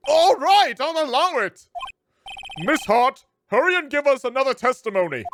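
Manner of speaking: high, loud flustered judge; the judge hurrying the witness, loudly. Acted. Voices the judge.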